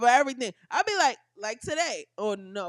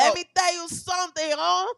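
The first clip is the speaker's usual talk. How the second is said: mock Chicano voice, a bit higher and louder; Mexican accent; 6 semitones higher, 4 dB louder than usual. Repeated Mexican imitation.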